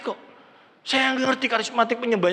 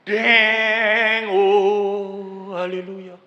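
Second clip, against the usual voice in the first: charismatic worshipper; much slower than usual. Shown as a demonstration. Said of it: "yang satunya orang karismatik".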